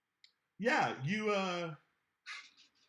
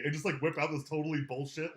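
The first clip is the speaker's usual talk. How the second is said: usual speaking voice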